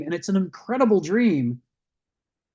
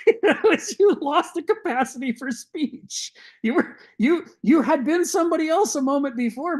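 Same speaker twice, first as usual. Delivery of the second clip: real laughter bubbling through, higher voice; laughing while talking; 10 semitones higher than usual. Real, not acted. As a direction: talk through a rising fit of chuckles. Amused lecturer laughing through the point.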